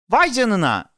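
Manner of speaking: high, loud cry of wonder; amazed shout. A narrator's reading. Boy discovering something in the water, amazed shout. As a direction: exclaim in astonishment. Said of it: "diye bağırdı".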